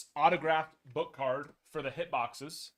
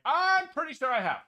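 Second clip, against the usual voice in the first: exasperated retort; 12 semitones higher, 8 dB louder than usual. Retold or imitated. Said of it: "And I was like".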